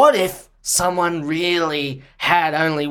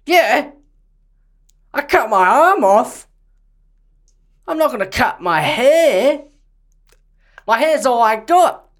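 higher and louder, mock outraged; indignant outburst; 8 semitones higher, 4 dB louder than usual. Actor refuses to cut his hair for the role, hammed-up outrage. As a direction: protest like a wounded diva.

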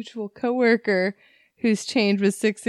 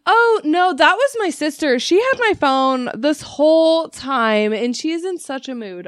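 high, louder sister insisting; mock sister voice; 6 semitones higher, 5 dB louder than usual. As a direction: play the caller denying it, pitched up. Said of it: "She goes".

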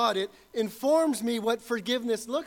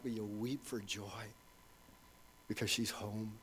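soft tender hush; 10 semitones lower, 13 dB quieter than usual. Acted. Greeting daughter home from school.

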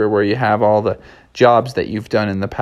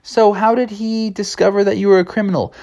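prim clerk voice; 10 semitones higher than usual. Acted. Clerks ask, high.

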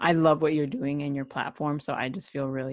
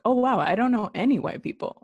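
mock surprise; 8 semitones higher, 4 dB louder than usual. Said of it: "it made me realize kind of like".

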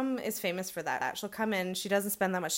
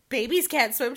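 louder, sarcastic disbelieving question; mock incredulous; 4 semitones higher, 8 dB louder than usual. Friend mocking mom's claim, sarcastic and loud. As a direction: toss the claim back as a joke.

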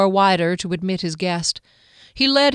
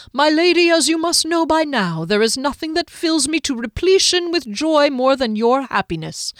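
high, loud, theatrical gallantry; courtly, flowery halfling; 6 semitones higher, 4 dB louder than usual. Acted. Halfling offering his lady any dish, courtly and effusive. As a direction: gush with florid, old-fashioned courtesy.

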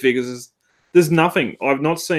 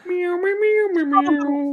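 high squeaky meowing; nervous cat voice; slower than usual. Pretend. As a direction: meow like a nervous kitten. Mock meowing during toy chat.

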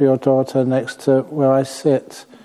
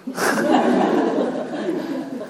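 breathy high giggle; airy laugh; 9 semitones higher, 5 dB quieter than usual. Real, not acted. Monk laughs at the wife refusing to call, airy giggle. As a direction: let a soft amused laugh escape.

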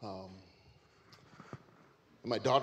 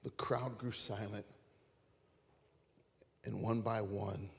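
quiet and moved; hushed emotional close; 7 dB quieter than usual. Real, not acted.

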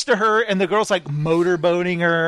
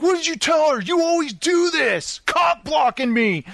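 high, shouted mock tirade; angry accusation; 9 semitones higher than usual. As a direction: act out his jealous outburst, high and worked up. Retold quarrel, he confronts her.

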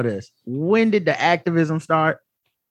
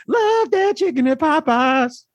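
high, slow jingle line; sing-song slogan, drawn out; Annie voice; 9 semitones higher, slower than usual. Retold or imitated. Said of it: "it ends with".

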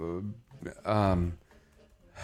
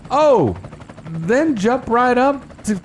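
surprised; 10 semitones higher, 4 dB louder, much slower than usual. Real, not acted. Speeds suddenly jump in report.

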